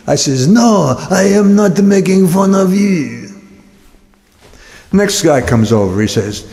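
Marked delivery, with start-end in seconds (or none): 0.5-3.3 put-on high, slow French-accented denial; mock French accent. Clerk denies in accent.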